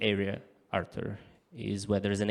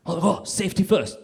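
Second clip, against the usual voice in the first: eager obedience; 7 semitones higher, 4 dB louder than usual. Acted. Foreigner obeying the sign eagerly.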